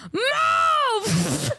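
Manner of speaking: high, drawn-out mock yell; long, high-pitched holler; imitated yell. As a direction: yell it like an impatient fan in the crowd. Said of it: "the girls behind us yelled at him".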